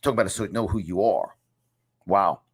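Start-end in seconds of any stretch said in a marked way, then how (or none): none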